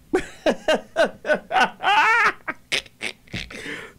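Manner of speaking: high-pitched burst of laughter. Real, not acted. Host laughs at the question just asked.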